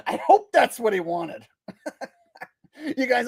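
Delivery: laughing through the words; real giggles breaking into speech. Real, not acted. Streamer cracking up after his own impression, laughing.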